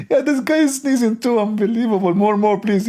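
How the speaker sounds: very high, giddy god voice; mock delighted god. Speaker mocks Allah enjoying sneezes in squeaky delight. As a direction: squeal as an overjoyed deity. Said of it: "like Allah, like".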